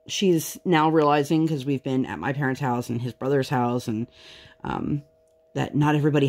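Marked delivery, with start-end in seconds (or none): none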